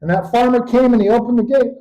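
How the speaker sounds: ordinary delivery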